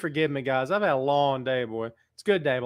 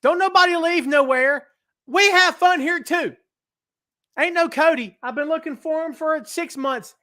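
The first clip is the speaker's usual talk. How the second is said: hyped up; 12 semitones higher, 5 dB louder than usual. Real, not acted. Hyped shout-out to chat.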